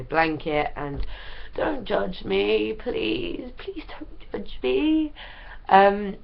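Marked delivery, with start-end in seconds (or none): 1.6-5.1 slow, rising high little whine; mock pleading. Ill vlogger begging viewers not to judge, mock pleading. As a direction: plead playfully, drawing out the words.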